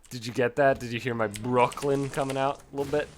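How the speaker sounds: ordinary delivery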